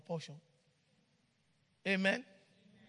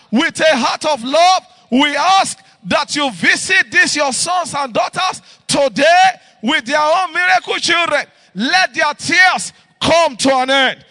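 loud, fervent shouted prayer; fervent prayer preaching; 6 semitones higher, 16 dB louder than usual. Acted. Pastor praying over the families, fervent shouted prayer. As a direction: pray out over the congregation with rising fervour.

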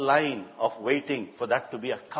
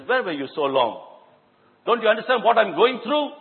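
angry demand; 8 semitones higher, 6 dB louder than usual. Acted. Frantic father confronts late doctor.

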